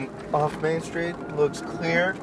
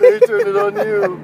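high laughing delivery; cracking up while talking; 4 dB louder than usual. Real, not acted. Laughing at the turnaround.

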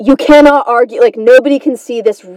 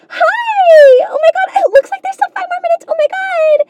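put-on squeaky-high, gushing girl voice; hammed-up bubbly innocent girl; positive cute girl. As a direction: chirp it, sugary and delighted. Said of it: "she'd be all positive and, and cute".

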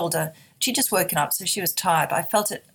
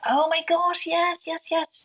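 high, elated yes, yes, yes; overjoyed realisation; 11 semitones higher, slower than usual. Retold or imitated. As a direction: let the joy burst out of you as it dawns. Joyful realisation, retold inner voice.

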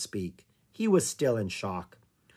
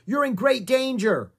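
high, louder urgent shout; shouted warning; 11 semitones higher, 4 dB louder than usual. Acted. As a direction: yell at the kids to get back. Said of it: "Jomo shouted".

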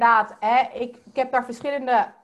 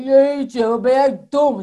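much louder, mocking put-on voice; ego in another voice; 10 dB louder, slower than usual. Shown as a demonstration. The ego mocking Daisy, loud and cartoonish. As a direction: sneer it out like a cartoon bully. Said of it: "zo gaat praten".

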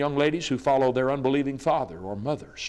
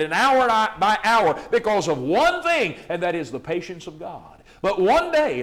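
rising, louder, higher fiery sermon climb; preaching at full force; 8 semitones higher, 8 dB louder than usual. Acted. Preacher to his congregation, thundering sermon. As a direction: build the fire with every line.

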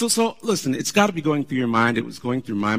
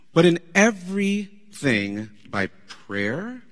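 slow, deliberate scripture reading; much slower than usual. Acted. Pastor reading Philippians to the church, slow and measured. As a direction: give each phrase of Scripture its weight.

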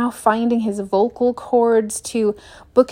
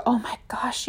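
breathy hushed gasp; mock whisper; 8 dB quieter than usual. Traveler gushing to the family at dinner, whispered. Mocked dreamy travel story.

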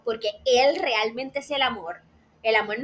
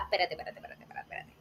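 softer and softer, ending in a whisper; fading to a whisper; 7 semitones lower, 13 dB quieter, much faster than usual. Retold or imitated. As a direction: ease off until it's only breath. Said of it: "tú te quedas". (espérate dicho cada vez más bajo hasta susurrar)

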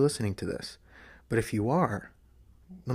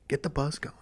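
whispering; 4 semitones higher than usual. Shown as a demonstration. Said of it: "whisper bits through the vlog".

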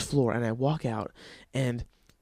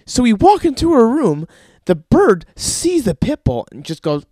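ordinary talk, nothing marked

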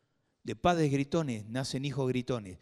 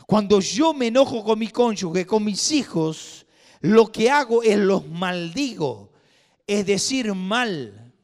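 louder, higher and slow preaching; preaching at full force; 7 semitones higher, 10 dB louder than usual. Acted. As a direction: drive each phrase home slowly. Preacher raising his voice.

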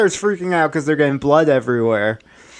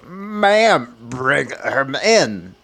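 eerie dispatcher voice; much slower than usual. Retold or imitated. 911 dispatcher ordering Donna over the phone, slow and eerie. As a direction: drag it out like a haunted operator. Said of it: "they goes".

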